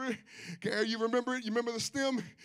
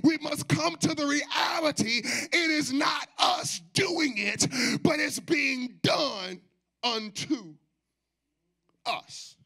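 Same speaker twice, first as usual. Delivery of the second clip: loud, rhythmic preacher cadence; full-force preaching; 4 semitones higher, 3 dB louder than usual. Acted. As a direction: preach it out, half singing.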